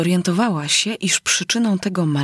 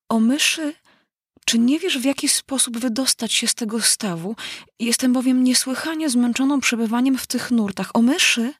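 put-on higher-pitched girl making a formal appeal; polite pleading heroine; 5 semitones higher than usual. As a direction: call out courteously and plead for help. Alinka formally addresses the mouse.

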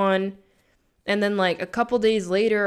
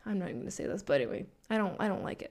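much softer, dropping to a near whisper; hushed aside; 10 dB quieter, much faster than usual. Real, not acted. Speaker holds back an opinion on the name, dropping to a hushed aside. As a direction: trail off under your breath.

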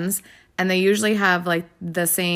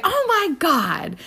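high, slow gushing fan voice; gushing lady; 10 semitones higher, slower than usual. Retold or imitated. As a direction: gush like a delighted stranger. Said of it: "she was like".

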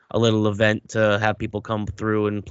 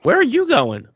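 mock alarmed; 14 semitones higher, 6 dB louder than usual. Teasing friend mid-banter.

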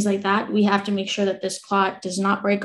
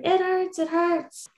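high whimper; 9 semitones higher, slower than usual. Retold or imitated. Complaining to the bedside team about pain, whimpering high. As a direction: whine it like a hurt child.

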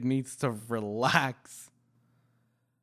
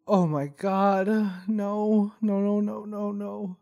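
panicked; 6 semitones higher than usual. Real, not acted. Panicked reaction to scene.

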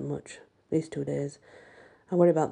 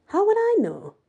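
exasperated; 7 dB louder than usual. Acted. Teen snaps back at Madame.

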